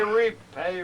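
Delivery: Repay called out high and loud; Repay rings out as the music swells; ringing call. Acted. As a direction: throw Repay out like a summons.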